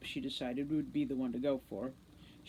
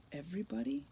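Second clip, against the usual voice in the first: 1 nervous disbelief; 5 semitones higher, 5 dB quieter than usual. A narrator's reading. Director swallows, incredulous.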